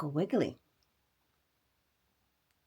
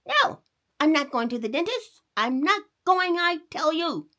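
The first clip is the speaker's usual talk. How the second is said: put-on high, loud refusing boy; a stubborn kid putting his foot down; defiant boy; 9 semitones higher, 5 dB louder than usual. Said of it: "cried Sonny".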